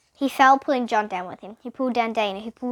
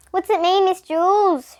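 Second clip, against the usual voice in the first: put-on higher, louder child calling out; shouting; 8 semitones higher, 6 dB louder than usual. A narrator's reading. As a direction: shout the question across the class. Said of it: "shouted Leslie".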